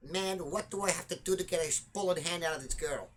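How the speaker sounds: put-on light Dutch accent on English. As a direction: imitate a Dutch player, lightly. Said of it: "Dutch accent there".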